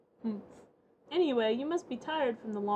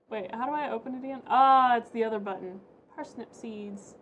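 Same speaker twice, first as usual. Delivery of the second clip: ordinary talk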